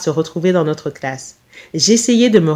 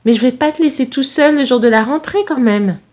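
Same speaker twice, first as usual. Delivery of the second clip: higher-pitched mother hen; lightly acted anxious maternal voice; fussing mother; 5 semitones higher than usual. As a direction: protest with motherly concern. Fussing mother protests to son.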